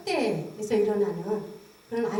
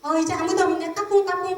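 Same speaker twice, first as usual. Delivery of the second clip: higher, louder singsong peekaboo; lightly voiced mother cooing 까꿍 at baby; mother playing peekaboo; 6 semitones higher, 5 dB louder than usual. Imitating a depressed mother playing peekaboo with her baby, higher and louder. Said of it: "똑같은 상황에서 하는 말입니다".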